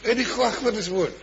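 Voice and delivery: the fed-up beadle bursting in, half-shouting; retold shout. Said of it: "וצועק".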